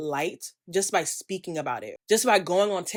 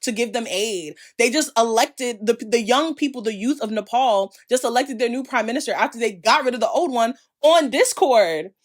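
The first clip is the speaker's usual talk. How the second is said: louder and higher, fired-up rallying; passionate rant; 4 semitones higher, 5 dB louder than usual. Real, not acted. Urging followers the world is waking up, loud and fired up. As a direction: rouse the audience like a protest speech.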